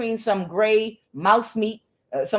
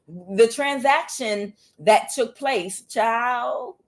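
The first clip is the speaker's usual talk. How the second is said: ordinary delivery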